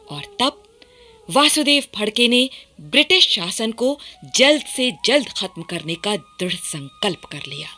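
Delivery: ordinary talk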